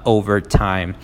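ordinary talk, nothing marked

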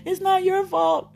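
self-encouragement. Retold or imitated. Voicing her own kind inner voice, high and tearful. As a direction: plead with yourself through tears. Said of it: "I'm trying to encourage myself".

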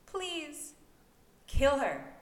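high, rising to loud plea; pleading woman. A narrator's reading. Merope begs, high and pleading, growing loud. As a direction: beg from the edge of death.

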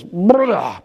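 imitated retch of a sick little dog; mock vomiting. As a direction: act out throwing up noisily. Imitates Chihuahua throwing up.

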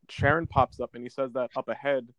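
ordinary talk

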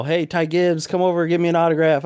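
imitated fan hailing a driver; imagined friendly fan call. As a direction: call him over like a keen fan. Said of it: "now I'm expecting, you know".

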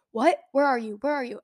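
usual speaking voice

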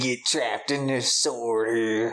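slow, drawled Southern soldier; Southern accent impression. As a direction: put on a thick Southern drawl. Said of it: "horrible Southern accent".

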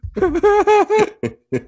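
high-pitched burst of laughter; real high laugh. Real, not acted. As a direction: crack up laughing. Reacts to friend's joke.

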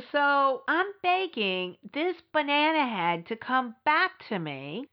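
usual speaking voice

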